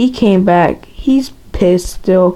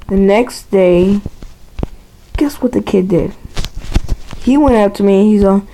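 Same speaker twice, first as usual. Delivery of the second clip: ordinary talk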